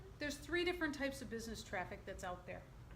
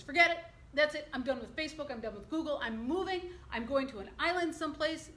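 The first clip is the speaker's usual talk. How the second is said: put-on loud, higher fed-up old man; appalled outburst; 4 semitones higher, 5 dB louder than usual. Appalled dad quits Facebook and Google, loud and fed up. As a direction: throw up your hands, loud and done. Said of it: "my father is appalled by this".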